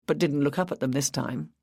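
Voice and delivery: ordinary delivery, nothing marked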